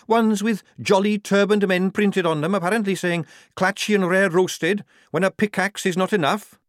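high, loud sarcastic soldier; sceptical soldier. Acted.

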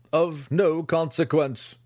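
slow, high, scornful dismissal; dismissive. Acted. Master dismissing the dead child to his students, slow and scornful. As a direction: toss it off as worthless. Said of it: "He laughed to his students".

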